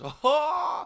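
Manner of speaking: high loud astonished "Oh!"; surprised crowd gasp. Retold or imitated. Whole room reacts to zinger.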